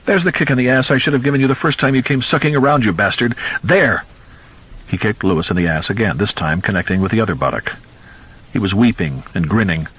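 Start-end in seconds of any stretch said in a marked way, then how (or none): 0.1-4.0 gloating yell. Acted. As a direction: spit it out fast while kicking. Weeping, grinning old man kicking.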